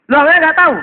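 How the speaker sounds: tiruan bentakan polisi, sangat keras dan tinggi; polisi membentak heran. Acted. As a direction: cecar pelanggar dengan suara meledak. (memerankan polisi tak percaya, lantang)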